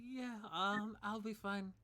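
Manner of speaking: embarrassed. Acted. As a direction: brush off concern after being humiliated. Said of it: "clearly embarrassed from the whole experience".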